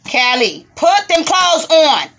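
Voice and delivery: real exasperated holler across the room; scolding. Real, not acted. Mother telling Callie to put clothes on, scolding loudly. Scolding a child mid-video.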